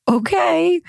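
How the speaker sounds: exasperated. Retold or imitated. High exasperated reply.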